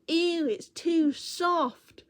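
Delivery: high, slow, drawn-out mock disgust from a little girl. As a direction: stretch the ew like a picky child. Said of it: "she picked it up and went".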